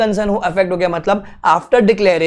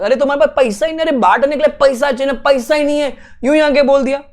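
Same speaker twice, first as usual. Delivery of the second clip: exasperated scoffing; 7 semitones higher, faster than usual. Real, not acted. Scoffing at broke company's promise.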